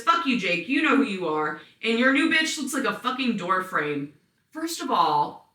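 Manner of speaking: ordinary delivery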